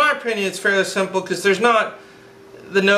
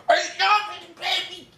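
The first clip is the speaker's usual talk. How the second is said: squealing delight; 11 semitones higher, 4 dB quieter than usual. Real, not acted. Gushing about new job.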